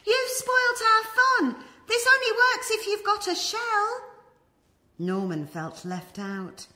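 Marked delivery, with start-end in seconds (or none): 0.1-4.0 high, loud squeaky snail chorus voice; snails scolding. Acted. Snails scold Norman for spoiling their fun, high and loud. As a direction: shriek it like cross little snails. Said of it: "they cried".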